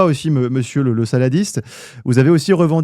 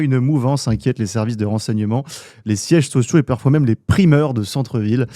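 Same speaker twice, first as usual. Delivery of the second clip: ordinary delivery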